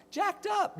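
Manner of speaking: goofy high-pitched punchline; squeaky goofy voice. Shown as a demonstration. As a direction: pop the punchline up into a cartoon squeak. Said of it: "That's my favorite line".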